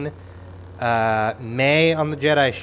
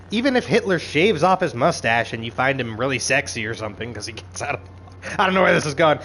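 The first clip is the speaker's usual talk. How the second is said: ordinary talk, nothing marked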